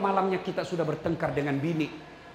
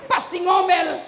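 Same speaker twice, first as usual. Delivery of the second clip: high, loud mock outburst; mock shout; 10 semitones higher, 6 dB louder, slower than usual. Preacher to husbands, a high loud mock shout. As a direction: blurt it out like a scolded husband.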